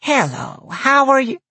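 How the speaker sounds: put-on high, sweet old lady greeting; sweet old granny. A narrator's reading. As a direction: greet like a kindly grandma. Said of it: "looked up at me sweetly".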